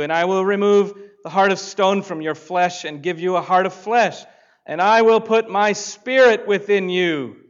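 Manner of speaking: impassioned scripture reading. Acted. Pastor reading Ezekiel to the congregation, impassioned and loud. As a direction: proclaim each promise with mounting force.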